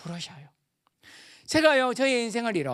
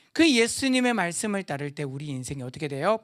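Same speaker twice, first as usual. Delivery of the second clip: ordinary talk, nothing marked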